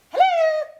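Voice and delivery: very high, loud sing-out hello; hammed-up silvery soprano greeting. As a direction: trill it high down the phone. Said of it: "high, silvery voice".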